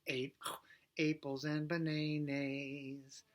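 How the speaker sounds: "eat" sung as "ate", "apples and bananas" as "aples and banaynays"; A vowel swap. Shown as a demonstration. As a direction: sing every vowel as a long A. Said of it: "We're gonna do the A sound".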